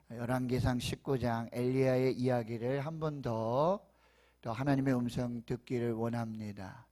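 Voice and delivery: normal talking voice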